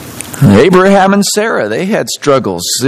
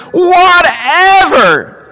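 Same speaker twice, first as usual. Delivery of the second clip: drawn-out shout; 10 semitones higher, much slower than usual. Acted. Preacher proclaiming John's words, slow high shout. Preacher quoting John, emphatic.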